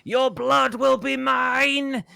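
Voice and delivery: high, loud, slow shriek; hag's shrieking death threat; screeching hag. Acted. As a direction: scream it as a bleeding hag lunging. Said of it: "screeching at him".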